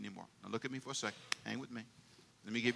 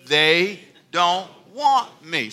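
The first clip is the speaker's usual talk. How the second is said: slow emphatic; 5 dB louder, much slower than usual. Acted. Preacher drags out the phrase.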